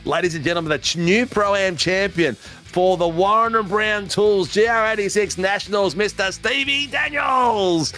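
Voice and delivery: big arena introduction, stretched and high; hyped-up announcer. Acted. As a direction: sell the champion like a boxing MC. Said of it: "I just hyped that up like crazy".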